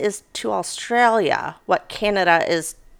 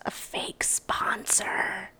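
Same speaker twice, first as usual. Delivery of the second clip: slow whispered aside; whispering; 11 dB quieter, much slower than usual. Real, not acted. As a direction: whisper it slowly. Aside after the ad.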